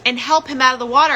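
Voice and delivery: high mock mother accusing; mother's voice. Mother blaming daughter about the pool, high. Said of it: "she's like".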